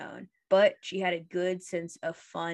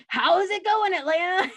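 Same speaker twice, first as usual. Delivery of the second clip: higher, louder stage hype call; concert host greeting; 10 semitones higher, 5 dB louder than usual. Retold or imitated. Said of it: "they would be like".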